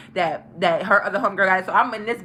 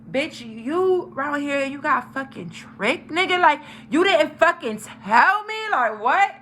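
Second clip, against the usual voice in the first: slow, drawn-out accusation rising higher; indignant confrontation; 4 semitones higher, slower than usual. Retold or imitated. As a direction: stretch each word as you call him out. Retold confronting the boy.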